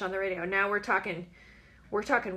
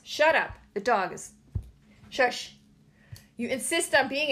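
snapping at dog; 3 semitones higher, 4 dB louder than usual. Real, not acted. Scolding the noisy dog.

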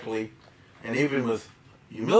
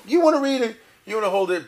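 mock eager reader; 9 semitones higher, 6 dB louder than usual.

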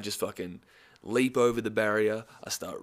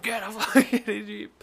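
high, loud angry outburst; mock furious yell; mad security guard; 11 semitones higher, 7 dB louder than usual. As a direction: imitate a bouncer losing his temper. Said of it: "he fucking got so mad".

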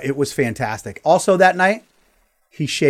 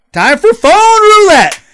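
big, drawn-out segment announcement, high and loud; announcer shout; 12 dB louder, slower than usual. Real, not acted. Host launching the phone segment, booming drawn-out announcement. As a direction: belt it out like a game show host.

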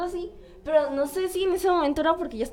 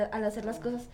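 ordinary delivery

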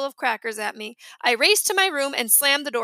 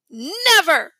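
defiant refusal; 5 semitones higher, 5 dB louder than usual. A narrator's reading. Boy refusing new baby.

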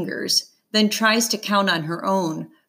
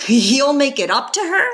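incredulous; 7 semitones higher, 5 dB louder than usual. A narrator's reading. Mom incredulous on phone.

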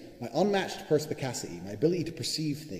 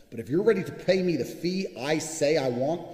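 ordinary talk

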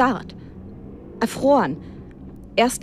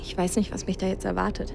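much softer, fast anxious murmur; quiet worry; 7 dB quieter, faster than usual. Real, not acted. Writer confides her unease about the meeting, soft and quick. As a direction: hush it, nervous and quick.

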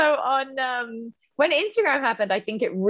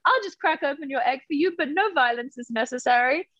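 put-on breezy cool-girl voice, higher and quick; cool chick voice; 8 semitones higher than usual. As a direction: lightly play a laid-back cool chick. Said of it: "cool chick who's like".

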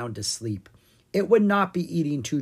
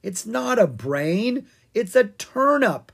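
exasperated correction; 8 semitones higher, 5 dB louder, slower than usual. A narrator's reading. Ella calms panicking Owen.

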